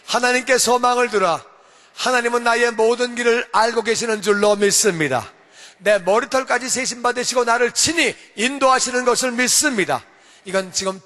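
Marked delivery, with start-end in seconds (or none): 0.0-1.4 louder, declaring it with the congregation; proclaimed together. Shown as a demonstration. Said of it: "다 같이". 2.0-10.0 confession of faith. Real, not acted. Confession of faith read aloud.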